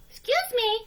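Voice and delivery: a bright little hen; hen's voice. A narrator's reading. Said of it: "she said".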